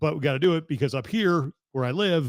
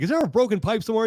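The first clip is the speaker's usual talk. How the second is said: alarmed; 8 semitones higher, 4 dB louder, faster than usual. Retold or imitated. Retold alarmed question to truck driver.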